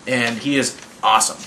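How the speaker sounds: ordinary talk, nothing marked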